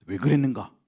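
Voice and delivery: ordinary talk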